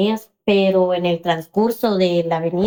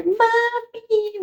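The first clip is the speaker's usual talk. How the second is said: high, drawn-out plaintive call; tearful child; 14 semitones higher than usual. Retold or imitated. Crying daughter phones her mother from detention, tearful. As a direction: imitate a crying child calling for a parent. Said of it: "porque su hija está llorando".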